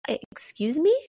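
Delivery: high, startled little question; taken aback. A narrator's reading. Teenager answers the strange shopkeeper, taken aback. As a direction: ask it high, as if thrown.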